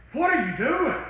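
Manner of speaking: loud exasperated plea, pitched high; raw cry to God. Shown as a demonstration. Preacher showing his own prayer, exasperated cry to God. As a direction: fling the question at God. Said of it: "sometimes my prayer is".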